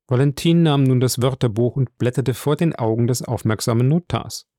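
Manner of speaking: ordinary delivery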